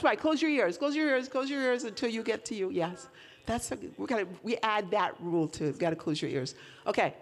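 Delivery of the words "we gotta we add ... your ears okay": ordinary talk, nothing marked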